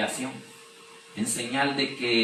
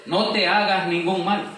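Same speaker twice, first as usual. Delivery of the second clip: raised, higher-pitched urgent call; louder and higher, an emphatic cry; crying out loudly; 6 semitones higher, 5 dB louder than usual. Acted. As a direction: read it as Paul calling out to stop the jailer. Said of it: "Pablo clamó a gran voz diciendo".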